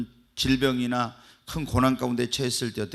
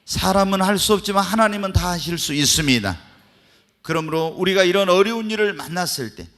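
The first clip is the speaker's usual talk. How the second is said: full-force preaching; 8 dB louder than usual. Acted. Pastor exhorting the church about thanksgiving, full-force preaching. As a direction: drive each line home to the congregation.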